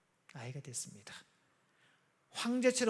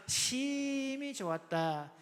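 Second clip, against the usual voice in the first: 심히 drawn out on a long held note; slow right down and stretch 심히; stress on 심히; 5 semitones higher, 4 dB quieter, much slower than usual. Shown as a demonstration. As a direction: linger on the first syllable of 심히. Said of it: "그냥 좋은 게 아니라".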